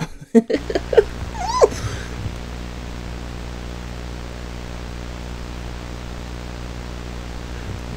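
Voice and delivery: long breathless high laugh; real giggling fit, high and airy; hysterical laughter. Real, not acted. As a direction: laugh helplessly after the fright. After scare, long laugh.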